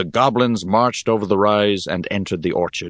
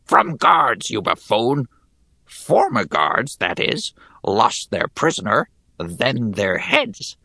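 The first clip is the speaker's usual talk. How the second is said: high, grating goblin voice; 5 semitones higher, slower than usual. Acted. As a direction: sneer it like a goblin soldier. Goblin mocks the digger.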